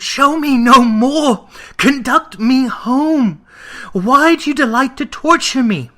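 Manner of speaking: high, loud, slow anguished begging; desperate plea. A narrator's reading. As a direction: beg the ghost to stop, in torment. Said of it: "cried Scrooge".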